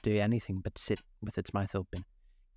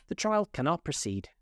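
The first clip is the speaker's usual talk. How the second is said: higher-pitched, solemn pronouncement; very grave; 7 semitones higher than usual. A narrator's reading. King halting the trial, in a grave voice. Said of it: "in a very grave voice".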